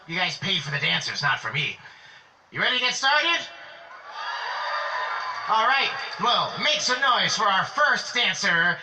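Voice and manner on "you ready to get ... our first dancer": rally speech. Acted. Host hypes crowd for dancer.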